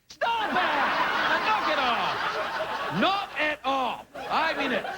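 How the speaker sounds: high, laughing mock telling-off; hammed-up high scolding. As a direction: shout at the pranksters, half joking. Scolding tomato picture pranksters.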